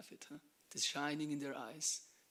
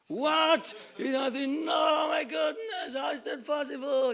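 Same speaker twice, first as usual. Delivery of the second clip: loud, high cry of disbelief; astonished shout; 12 semitones higher, 15 dB louder, slower than usual. Retold or imitated. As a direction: shout it out as if you can't believe it. Amazed retelling of disbelief.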